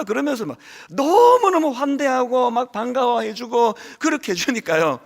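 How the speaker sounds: usual speaking voice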